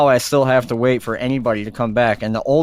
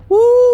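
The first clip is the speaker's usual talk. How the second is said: loud high whoop; 9 dB louder than usual. Real, not acted. Riled-up podcaster whooping after his rant, loud and high. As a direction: let out a big whoop.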